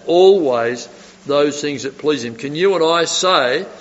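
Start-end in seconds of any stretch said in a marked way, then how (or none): 0.1-2.4 "Always" hit hard, the rest easing off; stress on "always". Shown as a demonstration. Preacher repeating the verse to the congregation, stress on "always". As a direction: lean on "Always", then let the phrase settle. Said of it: "I emphasise that".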